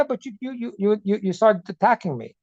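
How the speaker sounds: ordinary delivery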